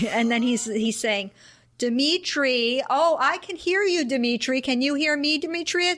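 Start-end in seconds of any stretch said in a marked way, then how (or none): 1.8-6.0 higher, sing-song patient coaxing; exaggerated patience, pitch lifted; talking to a child. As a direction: mimic someone soothing a small child on the phone. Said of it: "speaking a lot to him like he's a child".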